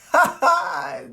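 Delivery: high burst of laughter; amused laugh. Real, not acted. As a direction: laugh out at a caught liar. Laughs at her changed story.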